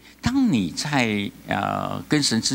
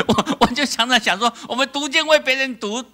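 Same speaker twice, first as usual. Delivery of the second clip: laughing; 11 semitones higher than usual. Real, not acted. Laughing at the sister's remark.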